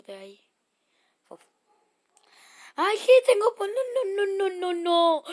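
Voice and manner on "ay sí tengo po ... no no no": high, loud panicked cries of no; panicked shrieking. Real, not acted. Kid gamer panicking as the potion goes wrong, shrieking. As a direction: shriek in real panic at the mistake.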